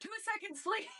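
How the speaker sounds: squeaky-high mock plea; high pleading. Retells pleading answer through door.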